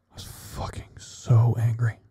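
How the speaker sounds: hushed, seething whisper; whispered anger. Real, not acted. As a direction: whisper it through held-in rage.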